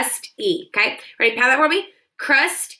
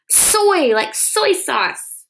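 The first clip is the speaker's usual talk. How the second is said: bright introduction; 7 semitones higher, 4 dB louder, much slower than usual. Shown as a demonstration. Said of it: "introducing the word".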